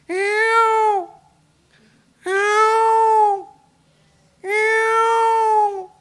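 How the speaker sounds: very high, loud shrieking bird call; peacock cry. Retold or imitated. Teller imitates a peacock crying for listeners, shrill cry. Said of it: "you've probably heard a peacock when they're crying".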